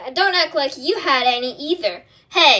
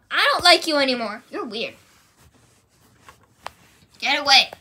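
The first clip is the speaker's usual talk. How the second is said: usual speaking voice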